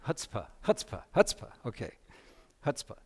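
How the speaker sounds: chutzpah with a throaty h at the start; Yiddish pronunciation. Shown as a demonstration. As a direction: say chutzpah as hutspa with a rasp from the throat. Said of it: "so you have to call it chutz".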